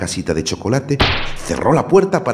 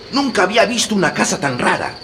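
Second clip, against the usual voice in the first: put-on amazed boy; 14 semitones higher than usual. A narrator's reading. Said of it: "exclamó Hansel".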